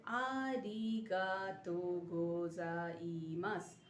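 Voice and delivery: "আরিগাতো গোজাইমাস" stretched out syllable by syllable; drawn out, careful, low teaching voice; slow drill for learners; very slow model pronunciation. Shown as a demonstration. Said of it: "ওরা বলে".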